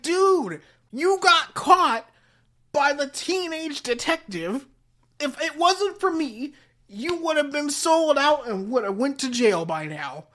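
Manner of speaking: high-pitched mock telling-off, loud at first; exasperated scolding. Boss scolds the guy caught by the teenage detective, exasperated.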